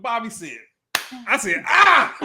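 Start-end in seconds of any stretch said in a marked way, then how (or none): 1.7-2.2 sudden high yelp of alarm; retold startled yelp; mock shout "Ah!". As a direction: yelp it like the shot just went wild. Retold shout at Bobby's shot.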